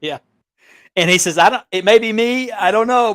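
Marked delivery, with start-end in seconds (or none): 1.4-3.1 lightly voiced higher put-upon scammer; a hint of a huffy scammer; mock offended whine. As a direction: play the wounded innocent, pitched up. Retold scammer whining on voice message.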